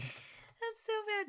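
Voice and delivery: "That's so bad" high and slow, mocking; high drawn-out echo. Real, not acted. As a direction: stretch it out high in mock despair. Echoing higher and slower.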